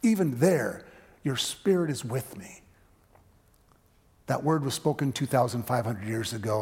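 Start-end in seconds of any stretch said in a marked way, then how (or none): 0.0-2.6 higher, louder preaching of the psalm; psalm recitation. Acted. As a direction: proclaim it with building fervour. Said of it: "the ancient psalmist said".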